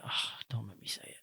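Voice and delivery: barely audible, breath-only reluctant mumble; reluctant whisper. Retold or imitated. Husband avoiding telling his wife the truth, reluctant whisper.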